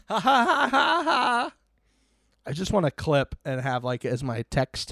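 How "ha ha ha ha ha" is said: high, forced imitation laugh; fake laugh. Host reliving a favourite moment, high fake laugh. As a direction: put on phony ha-ha laughter. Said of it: "Bear fake laughing".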